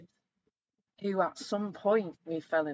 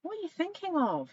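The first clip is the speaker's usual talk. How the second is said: higher, louder indignant question; mock rant; 8 semitones higher, 4 dB louder than usual. Wife retelling her rant at her husband, higher and louder. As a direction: scold him in playful disbelief. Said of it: "I'll have a little rant at him".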